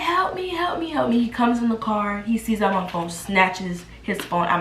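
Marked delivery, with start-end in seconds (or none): 0.0-1.2 high-pitched mock cry for help; mock screaming. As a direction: act out screaming for rescue without full volume. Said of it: "I'm screaming, like".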